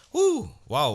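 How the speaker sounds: startled yelp. Retold or imitated. Needle pushed on his hip.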